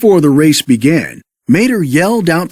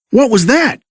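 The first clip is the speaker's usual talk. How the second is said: yelled; 7 semitones higher than usual. Acted. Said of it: "he yelled".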